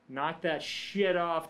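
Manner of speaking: slow, half-strength mock yell; retold yell. Man yelling at frat bros after work, retold yell. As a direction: imitate hollering at them, holding back. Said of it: "at the top of my lungs".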